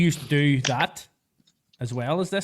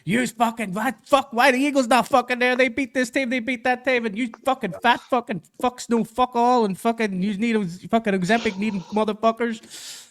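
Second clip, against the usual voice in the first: mock-furious rant, pitched higher; imitating angry fan; 8 semitones higher than usual. Host mimicking an Eagles fan raging over power rankings, mock fury. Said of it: "and he's like".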